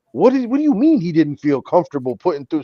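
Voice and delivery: retold anger at the shop manager; heated disbelief. Retelling the argument with the manager over missing books, heated. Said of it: "So now I'm getting hot".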